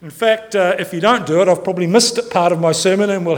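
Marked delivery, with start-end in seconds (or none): none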